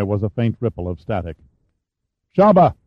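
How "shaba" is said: loud high call of a name; captain shouts for Shaba over the radio; shouting into the mic. A narrator's reading. Captain yelling for his gunner on the microphone, loud. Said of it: "Fox shouted".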